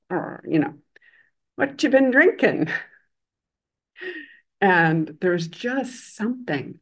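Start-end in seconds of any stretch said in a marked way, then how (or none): 1.5-2.7 high, louder, eager question; delighted friendliness. Retold or imitated. Old man asking the drunk about his drink, delighted. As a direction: ask it brightly, pitched up with delight. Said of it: "beaming with delight at this man".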